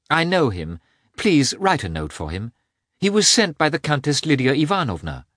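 lightly voiced society lady; princess asking a favour.

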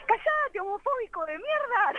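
imitando a chicas en voz muy aguda; chicas retando, imitadas. Retold or imitated. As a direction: reto agudo y sin gritar, en tono de parodia. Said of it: "de pronto le decían". (imitación aguda de chicas que mandan callar)

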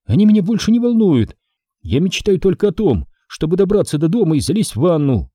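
Audrey fed up about her lost shoes; disgusted outburst. Acted. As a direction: vent your disgust. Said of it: "с отвращением произнесла Одри".

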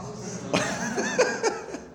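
high, wheezy laugh; real laugh. Real, not acted. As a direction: laugh at your own punchline. Laughs at his own punchline. (اپنی بات پر ہنسی)

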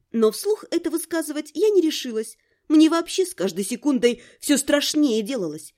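plain reading voice

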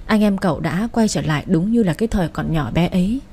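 usual speaking voice

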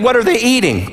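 ordinary talk, nothing marked

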